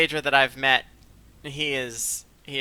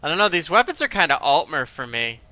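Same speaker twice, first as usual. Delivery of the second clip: ordinary talk, nothing marked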